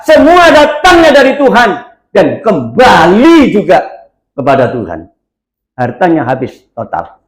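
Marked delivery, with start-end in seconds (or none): 0.1-5.1 proclaiming Job's words. Retold or imitated. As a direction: ring out the confession, then let it settle. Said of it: "dan berkata". (menyerukan kata-kata Ayub dengan lantang lalu merendah)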